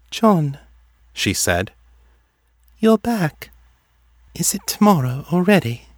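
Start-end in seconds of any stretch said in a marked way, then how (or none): 0.1-0.6 high, distant dreamlike voice; detached, dreamy. A narrator's reading. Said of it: "Her voice sounded detached and vaguely unsettled". 2.8-5.9 much higher, dreamlike voice; detached, vaguely unsettled. A narrator's reading. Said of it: "Her voice sounded detached and vaguely unsettled".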